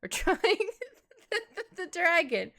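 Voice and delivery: laughs through the retake; laughing retry. Real, not acted. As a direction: restart the line while giggling. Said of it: "my voice cracked".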